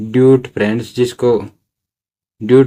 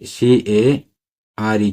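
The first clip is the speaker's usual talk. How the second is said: slow spelling; much slower than usual. Shown as a demonstration. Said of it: "teacher spells out care".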